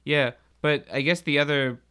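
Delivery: ordinary talk, nothing marked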